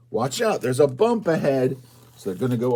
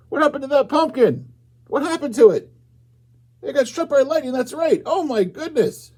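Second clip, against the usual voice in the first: much higher, louder, amazed; high excited gasp; 14 semitones higher, 4 dB louder than usual. Acted. Reading to children, sounding amazed. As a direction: gasp it out like a startled kid. Reader voices shocked story reaction.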